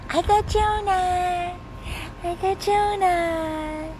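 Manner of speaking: high slow pet voice. Real, not acted. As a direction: coo it to the dog. Baby talk to the dog.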